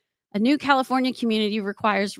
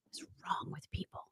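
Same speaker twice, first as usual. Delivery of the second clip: whispered aside; 5 semitones lower, 20 dB quieter than usual. Real, not acted. Muttered aside, unvoiced.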